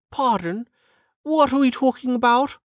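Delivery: high-pitched lady, casually asking; high voice of a casual aristocrat; casual noblewoman. Acted. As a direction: put on a high, airy lady's voice. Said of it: "said Lady Parr casually".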